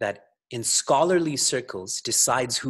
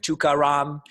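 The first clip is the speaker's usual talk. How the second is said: put-on "Tukaram" with a Canadian accent, slightly louder; 3 semitones higher, 4 dB louder than usual. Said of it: "in such a Canadian accent".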